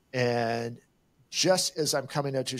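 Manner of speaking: ordinary talk, nothing marked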